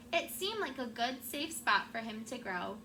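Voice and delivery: ordinary talk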